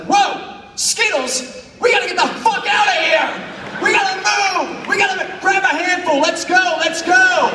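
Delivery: high, loud, fast panicked glee; hammed-up frenzied rallying cry; frantic excitement. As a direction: yell like the sink spat out treasure. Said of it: "I'm like".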